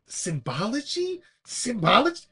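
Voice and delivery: rising, questioning, building up high and loud; movie character quote. Retold or imitated. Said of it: "He's like, like".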